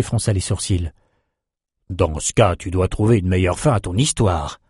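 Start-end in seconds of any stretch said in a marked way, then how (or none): 1.9-4.6 put-on old sorcerer voice. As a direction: speak as a kindly old mage.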